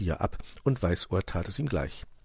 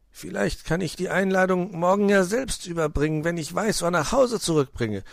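high-pitched, tentative proposal; scheming fox uncle; 10 semitones higher than usual. Acted. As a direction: suggest it innocently to buy time.

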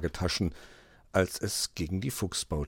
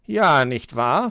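louder, slow delighted agreement; pleased; 6 semitones higher, 9 dB louder, slower than usual. Acted. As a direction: beam with pride as the fox. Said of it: "freute er sich".

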